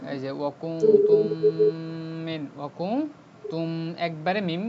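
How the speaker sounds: ওয়াকুম তুম with the first মিম merged into the next; merged meem recitation. Shown as a demonstration. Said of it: "মিম সাকিন বাদ দিয়ে একবারে মিমে চলে যাবে". (মিম মিলিয়ে পড়া)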